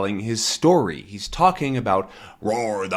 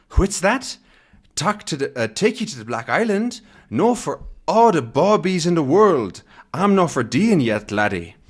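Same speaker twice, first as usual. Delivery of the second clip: Scottish boatman; 5 semitones higher than usual. Acted. Second boatman refuses.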